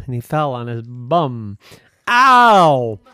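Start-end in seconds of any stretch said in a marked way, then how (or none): 2.1-3.0 sharp high yelp of pain; high ouch cry. Acted. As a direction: yelp like you just landed hard. Falls on his bum.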